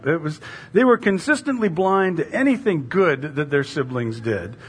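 ordinary talk

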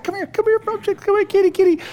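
high sing-song kitty call; coaxing the cat. Retold or imitated.

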